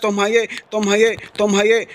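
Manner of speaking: ordinary talk, nothing marked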